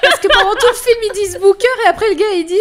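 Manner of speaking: loud, high, giggling retelling; laughing as she tells her co-host about Booker, giggling loud; laughing through words. Real, not acted. Laughing while retelling the film.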